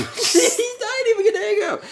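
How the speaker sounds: high, slow words through laughter; cracking up while talking; laughing. Real, not acted. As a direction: laugh it out in disbelief. Laughing at kids fighting over phone.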